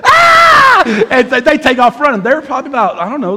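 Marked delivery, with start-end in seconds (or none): 0.0-0.8 very high, loud mock shriek; terrified kids' scream. Three boys ambushed at the door, shrieking in imitation. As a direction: scream like a startled child. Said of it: "And those kids said".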